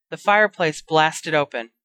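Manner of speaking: ordinary delivery, nothing marked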